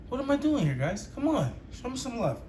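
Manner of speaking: mock exasperated plea. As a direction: beg with playful exasperation.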